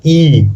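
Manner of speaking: ई held long with extra push; teacher demonstrating a stressed long ई; forceful long ई. Shown as a demonstration. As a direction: lean into ई as a long "ee". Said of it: "जोर से पढ़ना पड़ता है".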